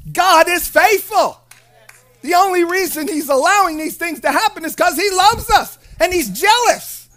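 loud, high, emphatic preacher's proclamation; full-force preaching. Real, not acted. Preacher telling the congregation God is doing something, full-force preaching. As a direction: drive each phrase home to the congregation.

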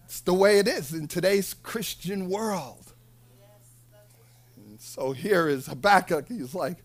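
ordinary talk

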